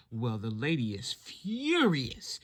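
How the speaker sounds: ordinary delivery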